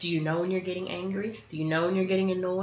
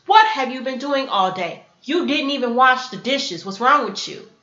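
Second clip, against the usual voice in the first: loud, higher-pitched scolding, acted out; blaming; 4 semitones higher, 7 dB louder than usual. Coming home from work to undone dishes, blaming. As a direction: scold loudly, hitting each accusation. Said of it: "when you're blaming someone".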